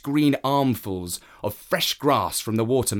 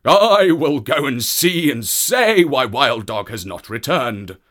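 loud, stamping horse voice; a horse demanding answers; Wild Horse voice; 3 semitones higher, 7 dB louder than usual. Acted. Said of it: "stamped with his foot and said".

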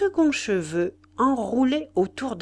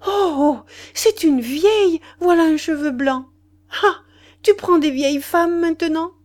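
much higher and louder, a strident cry of nervous joy; nervous shrieking glee; 8 semitones higher, 7 dB louder than usual. A narrator's reading. Mistress mocking her lover's old woman, shrill nervous glee. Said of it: "un cri strident de joie nerveuse".

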